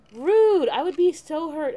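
high, loud burst of indignation; playfully scandalized, pitched right up; mock offended. Player reacts to the daughter's cookie remark, mock offended.

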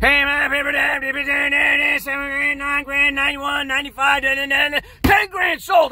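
high, loud rapid-fire chant; auctioneer. Retold or imitated. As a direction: imitate a droning auctioneer building to a shouted sale. Tape of auctioneer replayed.

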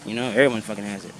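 ordinary delivery

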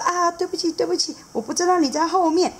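high, quick sorry; flustered high apology; apologetic seahorse dad. Acted. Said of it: "海马爸爸说".

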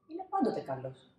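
ordinary delivery

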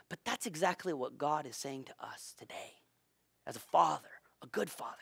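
soft whispered tenderness; tender unvoiced whisper. Real, not acted. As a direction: hush it like a secret.

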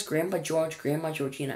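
ordinary delivery

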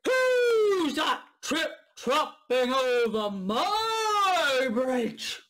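slow, loud, drawn-out roar; troll roar. Acted. Troll roaring at whoever crosses his bridge. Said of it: "he roared".

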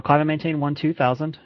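normal talking voice

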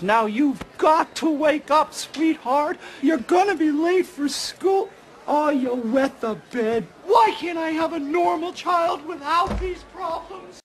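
high falsetto mother voice; mock mother. Parent waking a child late for school, mock mother falsetto. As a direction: put on a high-pitched, fretting parent.

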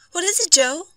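high, worried woman's question; anxious wife. A narrator's reading. Wife asking about his bandaged foot, anxious. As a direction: pitch it well up, concerned.

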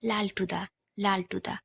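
লালটুদা repeated softly to himself; said under the breath. A narrator's reading. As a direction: savour the name লালটুদা quietly, inwardly. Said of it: "নামটা মনে মনে উচ্চারণ করলুম".

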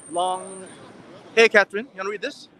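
ordinary talk, nothing marked

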